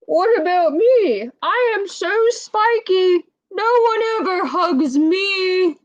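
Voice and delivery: high, whimpering sad voice; mock-tearful spiky creature; sad porcupine. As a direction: cry out that nobody hugs you, high up. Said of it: "He looks very sad".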